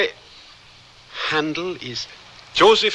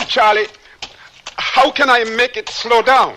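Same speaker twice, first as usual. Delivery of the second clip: alarmed; 8 semitones higher, 3 dB louder than usual. Acted. Greenhorn on a fast horse.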